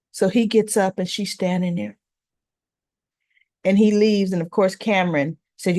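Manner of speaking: normal talking voice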